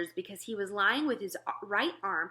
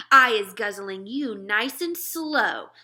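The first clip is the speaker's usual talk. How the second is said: louder, slow gloating threat; menacing giant; 10 dB louder, slower than usual. Acted. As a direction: savour the threat to your snack. Giant menaces soldier in his hand.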